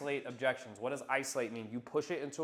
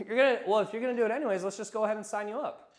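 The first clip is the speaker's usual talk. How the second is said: amused close; 7 semitones higher, 3 dB louder, faster than usual. Acted. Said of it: "I'm like".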